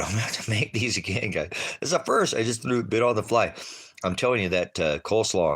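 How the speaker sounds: fast, high and loud excitement; excited. Real, not acted. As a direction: gush about the food. Excited about tasty food.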